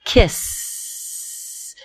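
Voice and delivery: kiss, the final S hissed out long without voice; held hissing S. Shown as a demonstration. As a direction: stretch the S in kiss into a hiss. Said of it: "hold that last sound".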